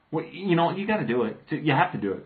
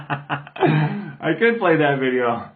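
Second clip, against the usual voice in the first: laughing into slow, drawn-out words; amused, words coming through laughter; real laughing; much slower than usual. Real, not acted. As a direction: crack up, then get the words out. Cracking up mid-sentence at own idea.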